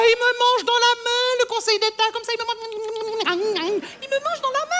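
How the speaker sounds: high, crowing delivery; gloating boast. Acted. Landlady boasting of power over the Conseil d'État, gloating. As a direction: crow it with comic relish.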